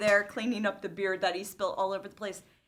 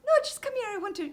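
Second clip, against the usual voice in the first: very high, urgent insistence; eager dad; 11 semitones higher, much faster than usual. Retold or imitated. Retelling her dad insisting she come see, giddy and very high. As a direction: squeal it like a giddy dad hiding a surprise.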